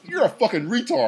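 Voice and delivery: mock heckle. Acting out heckler in film analogy.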